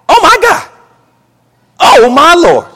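loud, high-pitched exclamation to heaven; appalled outcry. Real, not acted. Preacher aghast at paying for abortion, crying out. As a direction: cry out high and loud, stretching the second one. Cries out over the abortion demand.

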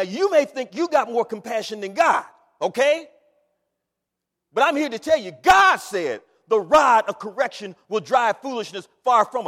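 loud, high preacher's cry; shouting sermon; full-force preaching. Acted. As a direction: hammer scripture at the congregation.

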